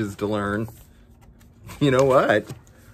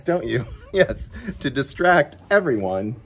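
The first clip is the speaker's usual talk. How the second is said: plain reading voice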